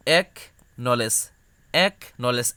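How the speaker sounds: এক, then নলেজ, then এক নলেজ; syllable by syllable. Shown as a demonstration. Teacher splitting acknowledge for students. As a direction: separate এক from নলেজ with pauses. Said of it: "এককে আলাদা করবা".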